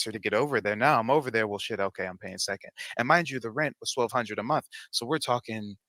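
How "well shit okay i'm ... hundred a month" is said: ordinary talk, nothing marked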